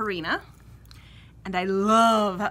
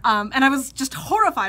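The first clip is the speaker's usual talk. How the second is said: ordinary talk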